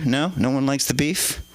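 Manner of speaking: ordinary delivery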